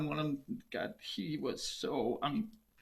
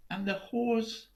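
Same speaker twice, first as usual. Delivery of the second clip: plain reading voice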